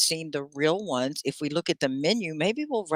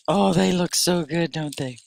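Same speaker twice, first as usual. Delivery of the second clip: normal talking voice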